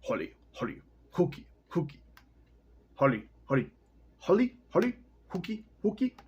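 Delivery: rhythmic sing-song grinding noise, rising high; grinding corn sound. Shown as a demonstration. Reader performing the sound of corn being ground, rhythmic chant. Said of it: "here's the sound of corn being ground up".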